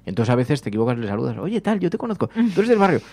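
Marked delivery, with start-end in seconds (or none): 1.4-3.0 raised pitch, imitating a chatty neighbour; higher-pitched mock greeting; mistaken greeter. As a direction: act out hailing someone you think you know. Acts out greeting a half-known neighbour.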